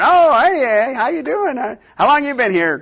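loud, high, surprised hello; surprised greeting. Retold or imitated. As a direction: greet them as if startled to find them there. Greeting the visitor finally found.

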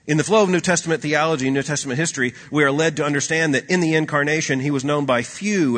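rising sermon fervour; preaching at full force. Acted. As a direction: drive the point home from the pulpit. Preaching build-up.

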